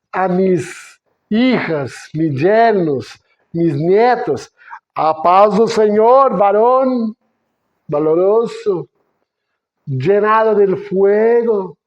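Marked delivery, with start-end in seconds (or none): 4.9-11.8 higher and louder, a blessing proclaimed with zeal; fiery churchy greeting. Acted. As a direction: boom it out like blessing a valiant man. Greeting family like a preacher.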